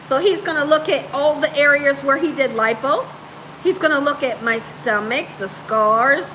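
usual speaking voice

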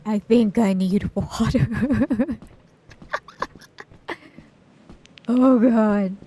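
stuffed from snacks; too full. Real, not acted. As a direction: talk like you ate too much. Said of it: "I ate too many snacks".

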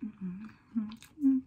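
chewing while humming; mouth full. Real, not acted. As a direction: lips closed around food. Seen 1: Bit the burger just before; lips pressed while still chewing during mm-hmm.

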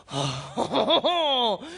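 long swooning "ohhh". Acted. Woman hearing a French accent, comic swoon. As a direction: melt into a drawn-out oh.

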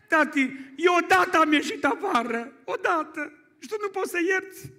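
put-on little girl's voice. Seven-year-old daughter to her father about forgiving, high childlike reproach.

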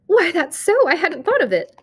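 light up with sudden relief; cry of joy. A narrator's reading. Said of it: "She gave a cry of joy".

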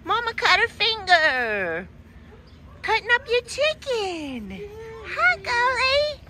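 put-on baby talk to a pet. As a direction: coo sweetly to the dog. Talking to the dog.

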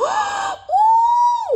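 very high, loud gasp of delight; shrieking gasp. Real, not acted. Vlogger pulling the cards she wanted, shrieking gasp. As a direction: gasp like you just won.

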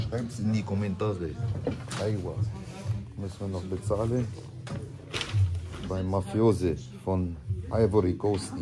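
ordinary talk, nothing marked

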